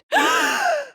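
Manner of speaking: high, loud gasp of shock; sudden gasp. Acted. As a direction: suck in air sharply.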